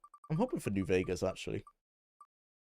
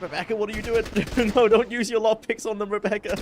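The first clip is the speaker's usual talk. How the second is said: laughing exasperation; 11 semitones higher, 5 dB louder than usual. Real, not acted. Teammate misusing lockpicks, laughing.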